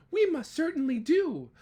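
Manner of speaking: high, loud piping voice; eager little mayor answering Horton; piped. Acted. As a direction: pipe it up like a tiny Who. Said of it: "piped the voice".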